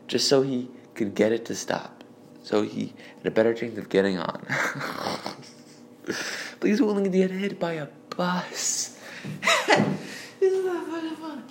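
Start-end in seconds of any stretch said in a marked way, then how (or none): 4.5-11.4 squeaky laugh through the words, much higher; can barely get the words out for laughing. Real, not acted. Cracking up at own story.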